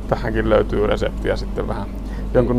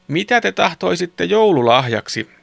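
grandmother asking; 7 semitones higher than usual. A narrator's reading. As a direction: ask the children eagerly as granny. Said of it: "kysyi mummo". (mummon korkea, kysyvä ääni)

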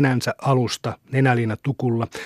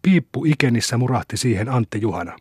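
ordinary delivery

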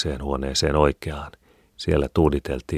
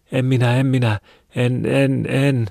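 very high, loud wail of refusal; high-pitched frantic shout; panicked cry; 10 semitones higher, 6 dB louder than usual. A narrator's reading. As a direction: cry out in desperate protest. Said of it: "huusi Mari".

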